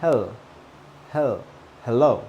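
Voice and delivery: HEL alone, ending on L, then whole HELLO; HEL syllable drilled. Shown as a demonstration. Teacher drilling the first syllable, HEL then HELLO. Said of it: "first syllable is like a line".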